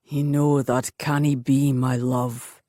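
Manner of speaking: lightly voiced warrior, low and strained; tormented lover refusing gently; pained rasp. A narrator's reading. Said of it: "he rasped in a pained voice".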